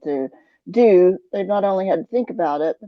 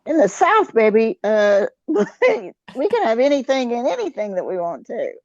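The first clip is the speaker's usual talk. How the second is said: proud Southern exclaim; 4 semitones higher than usual. Real, not acted. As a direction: declare Southern freedom with a laugh.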